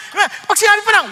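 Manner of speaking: ordinary delivery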